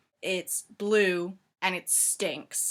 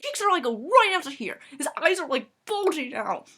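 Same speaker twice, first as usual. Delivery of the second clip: usual speaking voice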